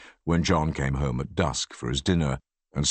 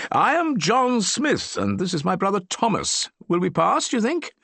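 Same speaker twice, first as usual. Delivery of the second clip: playful disguise. A narrator's reading. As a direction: show off the disguise. Mock disguise introduction.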